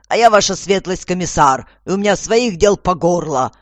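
loud, emphatic official complaint; harried commissioner. A narrator's reading. Commissioner explaining to the lady, loudly harried.

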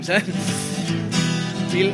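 ordinary talk

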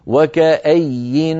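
وكأي held slowly, ending in a clear sakin nun; slow pausal وكأي ending in nun; stopping with nun. Shown as a demonstration. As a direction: stop on وكأي with a plain voiced nun. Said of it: "تقف كما أمامك بنون".

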